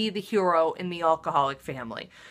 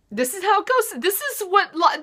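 much higher, choked tearful voice; 13 semitones higher than usual. Real, not acted. Talking to her audience while crying on live television. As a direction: push words through tears. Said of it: "and I'm crying".

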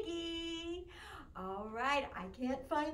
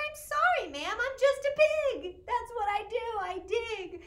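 higher, louder squeaky mock pig; piggy voice; 6 semitones higher, 6 dB louder than usual. Teacher retelling what the pig said, higher piggy voice. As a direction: play the piggy cheerfully. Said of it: "and he said".

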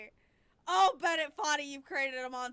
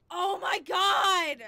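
slow, loud, higher wail; anguished shout; 5 semitones higher, 11 dB louder, slower than usual. Real, not acted. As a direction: cry it out in pain. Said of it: "just by screaming at this game".

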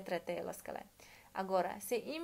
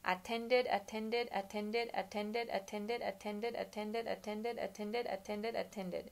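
drill repetition; much slower than usual. Shown as a demonstration. Teacher showing students how to practise by repeating. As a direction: chant "attended" ten times like a drill. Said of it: "Pratica sepuluh kali".

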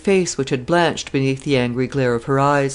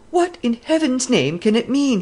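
much higher, urgent alarmed cry; 9 semitones higher than usual. A narrator's reading. Edward learns Mary is gone, alarmed cry. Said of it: "he cried".

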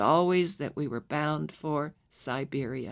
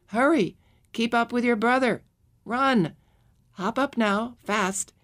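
put-on higher, louder urgent commands to a child; urgent calling; 5 semitones higher, 3 dB louder than usual. A narrator's reading. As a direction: hurry the lagging child along, calling out. Mother urging child onto train.

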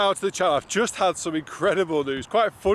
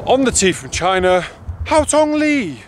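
posh voice; 3 semitones higher, 6 dB louder than usual. Pretend. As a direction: go really posh on the call. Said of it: "Shall I go, like, really posh?".

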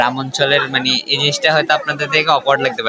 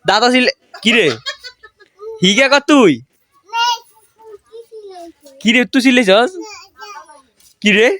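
half-joking scolding; 10 semitones higher than usual. Real, not acted. Questioning kids who shouted.

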